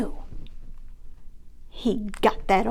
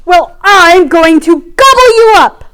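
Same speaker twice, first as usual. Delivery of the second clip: loud, high thundering roar; roaring troll; 8 semitones higher, 15 dB louder than usual. Acted. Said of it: "the troll thundered".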